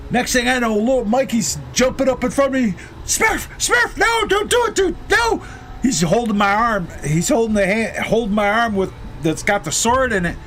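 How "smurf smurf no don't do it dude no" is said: high, shouted pleading friend; frantic pleading. Retold or imitated. Little Mikey shouting at Smurf to stop. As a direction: beg him to stop, voice cracking high.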